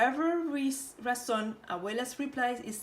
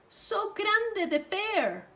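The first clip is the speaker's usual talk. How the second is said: higher, slow amazed; drawn-out wonder; 10 semitones higher, slower than usual. Acted. Roja remarking eyes.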